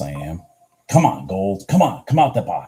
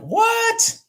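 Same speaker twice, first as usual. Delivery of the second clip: much higher, squeaky mock "What?"; mock disbelief; 5 dB louder than usual. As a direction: act out stunned disbelief. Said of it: "I'm like".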